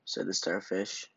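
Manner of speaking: usual speaking voice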